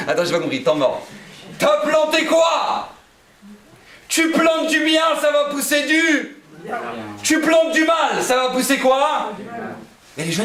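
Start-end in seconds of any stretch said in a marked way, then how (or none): 1.6-9.3 much higher and louder, slow and hammered; preaching at full force. Acted. Preacher's outburst on planting. (prêche à pleine voix, aigu et lent)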